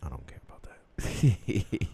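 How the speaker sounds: slow whisper; drawn-out teasing whisper; whispered aside. Pretend. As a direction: whisper it slowly, all breath. Teasing aside in age squabble.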